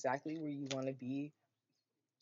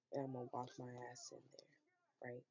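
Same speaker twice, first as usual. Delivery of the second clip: whisper; 3 semitones lower, 7 dB quieter than usual. Real, not acted. Hushed aside about liquor store.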